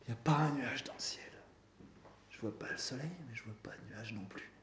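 quiet musing. A narrator's reading. Misfit with nothing to say, quiet musing. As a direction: muse softly, fading away. Désaxé, embarrassé, parle du ciel.

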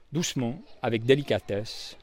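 usual speaking voice

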